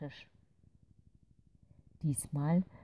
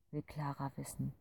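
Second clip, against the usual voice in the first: usual speaking voice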